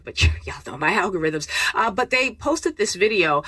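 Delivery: normal talking voice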